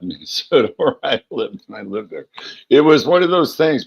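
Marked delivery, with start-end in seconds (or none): 2.7-3.9 higher and louder, caught up in the memory; light up recalling the concert; excited. Real, not acted. Telling viewers about Jingle Ball, excitedly.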